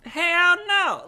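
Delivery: high, loud hell no; outraged refusal. Real, not acted. Friend hearing the story and refusing it loudly. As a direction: blurt it in disbelief.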